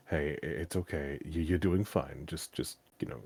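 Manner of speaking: calm someone down gently; reassuring. Retold or imitated. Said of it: "somebody else who was a rando was like".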